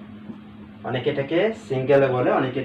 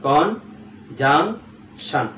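"Conjunction" very slow, as কন, জাং, শান; three well-spaced beats on "conjunction"; syllable by syllable; much slower than usual. Shown as a demonstration. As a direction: spell out the sound of "conjunction" piece by piece. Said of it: "তাহলে conjunction হচ্ছে".

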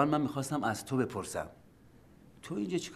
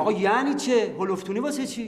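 louder, higher outraged objection; indignant protest; 9 semitones higher, 6 dB louder than usual. Acted. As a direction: protest loudly at the threat. Protesting being thrown out. (اعتراض بلند و عصبانی)

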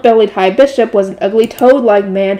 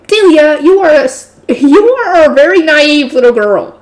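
sneering villain; 5 semitones higher, 4 dB louder, slower than usual. A narrator's reading. Villain count sneers at girl.

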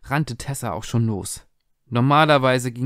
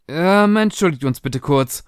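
a mildly nervous, hurried apology, raised; a touch of nerves; nervous stammer; 5 semitones higher, 5 dB louder than usual. A narrator's reading. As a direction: stammer it out, slightly flustered. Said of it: "stammelte sie nervös".

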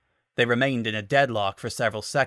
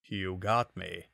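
low, soft, slow concession; a lazy drunk admitting defeat; drunk Panther, conceding; 4 semitones lower, 7 dB quieter, slower than usual. A narrator's reading. Panther conceding to Falco, low and soft. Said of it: "he peered at Falco appreciatively".

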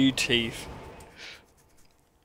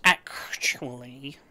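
whispered; 3 dB quieter, much slower than usual. Shown as a demonstration. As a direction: whisper "Actually" slowly with a rising tune. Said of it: "I don't sound like that, actually".

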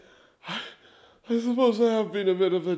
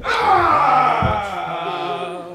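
loud high cry of delight; joyful shout; 4 semitones higher, 6 dB louder than usual. Acted. Uncle bursts in on his niece's play.

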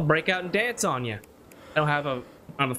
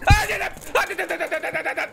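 high, loud nonsense yelling of syllables; random noises; 14 semitones higher, 4 dB louder, much faster than usual. Real, not acted. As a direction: blurt out scared gibberish noises. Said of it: "making just random noises".

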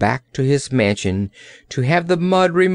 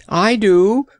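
higher, louder protest; crying out; 9 semitones higher, 5 dB louder than usual. A narrator's reading. Gardener's boy protesting his love, crying out. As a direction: cry it out in earnest. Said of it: "cried Pon".

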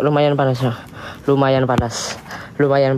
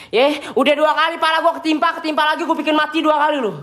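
higher-pitched, indignant complaint in one run; annoyed scolding; 9 semitones higher than usual. Real, not acted. Man scolding spirit that hit his head, indignant. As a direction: tell off the unseen attacker, pitch raised.

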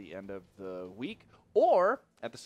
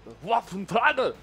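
"Waffenträger" loud and high, hammed up; showy, booming shout on "Waffenträger"; emphatic; 9 semitones higher, 10 dB louder than usual. Shown as a demonstration. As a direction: belt out "Waffenträger" with exaggerated emphasis. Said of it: "say it with that kind of emphasis".

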